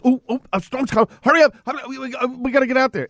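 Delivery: mock panic, high and rushed; hammed-up frantic scramble, pitched high; panicky power company. Mocking the power company rushing before a storm, hammed-up panic. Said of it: "not just".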